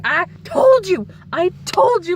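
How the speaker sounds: high, slowed told-you-so. Retold or imitated. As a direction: rub it in to mom. Scolding mom, told you so.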